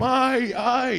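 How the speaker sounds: high, loud mock yelps; mock yelps of pain. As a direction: squeal like she's hurt. Girl yelps when kicked.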